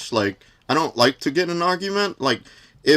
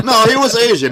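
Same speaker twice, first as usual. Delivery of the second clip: higher and louder through a laugh; 5 semitones higher, 3 dB louder than usual. Real, not acted. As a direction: laugh while insisting. Amused reply in the banter.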